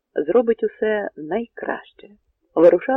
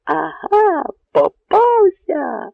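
high, long-drawn gloating yell; triumphant shout; 13 semitones higher, 5 dB louder, much slower than usual. A narrator's reading. Enemy rider thinks Bohun is caught, crowing loudly. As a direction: crow it slowly at your cornered prey.

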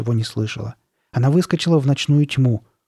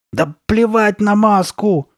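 high, loud, drawn-out angry cry; angry shout; 9 semitones higher, 5 dB louder, slower than usual. A narrator's reading. Carly Beth pulling at the mask, shouting angrily. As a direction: yell it crossly, pitched up. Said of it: "сердито закричала Карли Бет".

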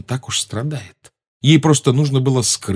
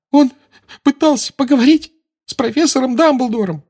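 sobbing; 4 dB louder than usual. A narrator's reading. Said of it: "всхлипнула Гермиона".

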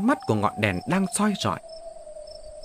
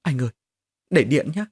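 timid higher-pitched wife's voice; trembling, frightened pleading; 4 semitones higher than usual. A narrator's reading. Scared wife begging husband to leave the light on, trembling. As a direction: plead haltingly, in small fearful bursts. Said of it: "Mụ run giọng bảo chồng".

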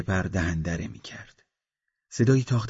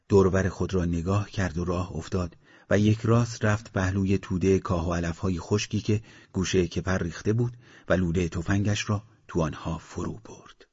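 plain reading voice

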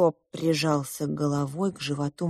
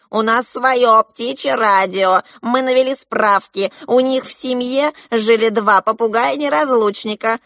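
loud, raised, higher parrot chatter; shouting parrots; 5 semitones higher, 6 dB louder than usual. A narrator's reading. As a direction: announce it like noisy parrots. Попугаи продолжают кричать. (громкий галдёж попугаев)

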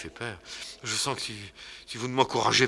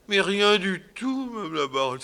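haughty baroness; 10 semitones higher, 3 dB louder than usual. Acted. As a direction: answer grandly, looking down. Baronne answers Fadinard haughtily.

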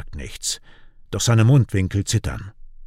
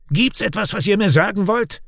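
raised, brusque challenge; gruff; 11 semitones higher than usual. A narrator's reading. Raik suspecting Kian of hiding something, gruff. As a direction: demand it brusquely, pitched high. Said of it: "fragt er barsch an Kian gewandt".

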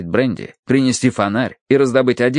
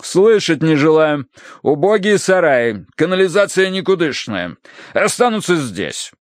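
decisive colonel; 7 semitones higher than usual. A narrator's reading. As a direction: sweep aside every objection. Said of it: "решительным жестом отметая любые возражения". (решительно, с расстановкой)

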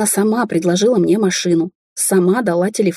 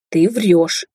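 flat verdict. A narrator's reading. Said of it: "припечатала Ника".

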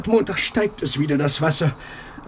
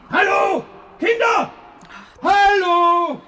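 put-on very high, loud call to the children; shouting upstairs; 3 dB louder, much slower than usual. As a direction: yell up the stairs for them. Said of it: "Du bist außer dir".

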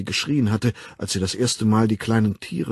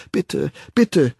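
put-on raised, pleading child's cry; weinendes Flehen; 5 semitones higher, 4 dB louder than usual. A narrator's reading. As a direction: beg urgently, a touch tearful. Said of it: "Weinend hatte er". (weinerlich flehen)

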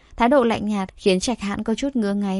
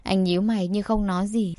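plain reading voice

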